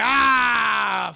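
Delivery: loud, high, long "ah"; exaggerated morning exhale, called out; mock contented sigh. Dad steps outside in the morning, with a big loud "ah".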